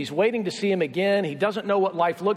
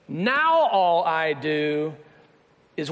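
long, slow swoop up on "now"; mock-dreary, dragged-out refrain; drawn-out lament; 3 semitones lower, much slower than usual. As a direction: stretch every word, sliding down after "now". Weary married refrain, drawn out.

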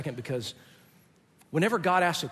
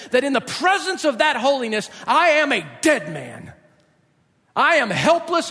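impassioned confession, building in pitch and force; Isaiah's overwhelmed despair; 5 semitones higher, 6 dB louder than usual. Acted. Preacher as Isaiah before God's holiness, desperate confession. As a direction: preach it as a man undone, pounding each admission. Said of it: "Isaiah was saying".

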